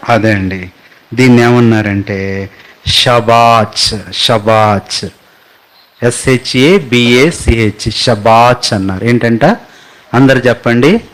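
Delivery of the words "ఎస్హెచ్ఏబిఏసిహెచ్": slow letter-by-letter spelling of ఎస్హెచ్ఏబిఏసిహెచ్. Shown as a demonstration. Pastor spells the word for the congregation, slowly letter by letter. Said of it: "spelling చెప్పేస్తున్నాను".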